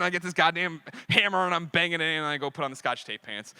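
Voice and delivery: usual speaking voice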